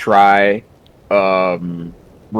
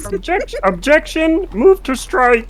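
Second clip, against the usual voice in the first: mock lawyer. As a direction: play a squeaky overacting lawyer. Mock courtroom objection bit.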